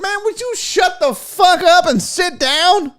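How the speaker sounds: high, drawn-out mock outburst; mock exasperation. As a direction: heckle in hammed-up exasperation. Imagined listener heckling Southern Einstein.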